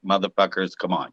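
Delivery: low, soft muttered scolding; muttered at the kids. Retold or imitated. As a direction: grumble it under your breath at the kids. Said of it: "we will look at our kids and be like".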